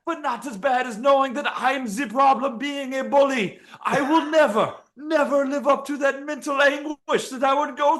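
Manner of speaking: hammed-up agony of a snapped arm; pained fighter. Fighter with a snapped arm, in a high hammed-up wail. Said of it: "he's like".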